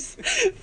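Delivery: high laugh at own slip; burst of laughter. Real, not acted.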